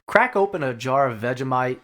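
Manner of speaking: hammed-up mock Australian accent; bad Australian accent. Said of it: "That's my worst fucking Australian accent".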